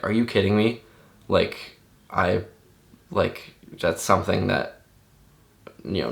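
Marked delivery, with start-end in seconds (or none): none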